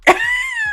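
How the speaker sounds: very high squealing laugh at her own joke. Real, not acted. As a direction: crack up at yourself.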